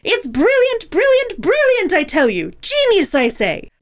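high, theatrical cartoon villain; over-the-top villain monologue, swooping high; Yzma. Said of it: "Yzma, Emperor's New Groove".

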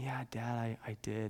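very quiet, low imitated whimper; small, hesitant confession to Dad, lightly acted; hushed retelling of a guilty kid; whimpering child. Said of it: "he just kind of whimpering".

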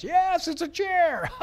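high, drawn-out mock yell breaking into laughter; imitated hilarity. Teenagers answer the preacher's silly question, high hilarious shout. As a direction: imitate a crowd of giggling kids shouting back. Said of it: "they thought it was hilarious".

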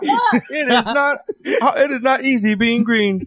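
slow, high, laughing try at Kermit; attempted Kermit. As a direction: stretch it out in a wobbly frog voice. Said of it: "I wish I could do the voice".

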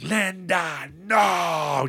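high mock wail of grief, overacted for an Oscar; hammed-up anguished cry. Borgo Nine mourning Linda, in a hammed-up high shout. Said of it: "Just really grabbing for that Oscar".

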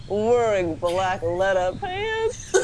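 normal talking voice

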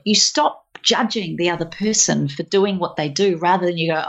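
normal talking voice

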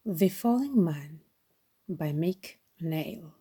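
usual speaking voice

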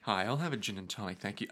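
hushed, quick drink order; quiet bar order. Pretend. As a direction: murmur an order to a bartender.

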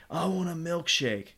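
put-on high whiny child voice asking for a milkshake; whiny kid. Imitating kid's demand.

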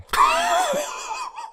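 whistle. Real, not acted. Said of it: "That was a good whistle".

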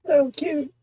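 high-pitched cooing at the cat. Real, not acted. Cooing at the kitty on the call. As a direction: gush over the kitty in a squeaky voice.

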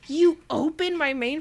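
loud, high indignant question; indignant disbelief. Retold or imitated. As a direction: act shocked and offended. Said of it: "she's just like".

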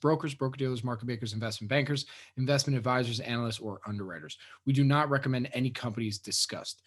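quiet, even disclaimer read; legal disclaimer. Acted. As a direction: read the fine print softly. Reads the closing disclaimer.

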